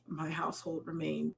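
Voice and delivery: ordinary talk, nothing marked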